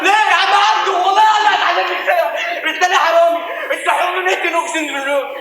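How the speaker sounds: loud high yelling at his father; outraged son yelling at his thief father; furious shout. Acted. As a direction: scream the betrayal in his face.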